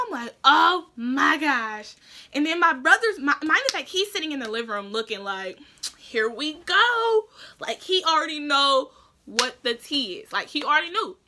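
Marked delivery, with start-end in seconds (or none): 0.4-2.0 slow, loud, stretched-out gasp of dismay; drawn-out oh my gosh. Retold or imitated. Reacting to her mom's question. 6.0-7.3 slow, loud, teasing sing-song; knowing here we go. Retold or imitated. Brother anticipating trouble.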